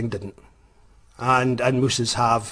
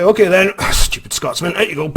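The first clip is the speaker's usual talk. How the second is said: scornful driver; 7 semitones higher, 5 dB louder than usual. Retold or imitated. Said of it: "he was like".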